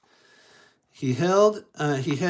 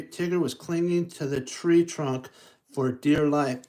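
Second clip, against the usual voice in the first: ordinary delivery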